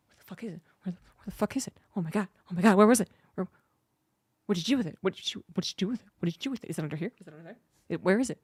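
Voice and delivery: hushed, breathless whisper as the cat searching. Retold or imitated. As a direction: whisper frantically like a hunting cat. Cat voiced searching for tassel.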